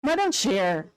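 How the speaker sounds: usual speaking voice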